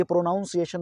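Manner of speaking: "प्रोनाउंसिएशन" with 'noun' in the middle; mispronunciation. Shown as a demonstration. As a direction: say "प्रोनाउंसिएशन" wrongly as pro-NOUN-see-ay-shun. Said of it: "नहीं है". ("प्रोनाउंसिएशन" के बीच में 'नाउन' बोलें)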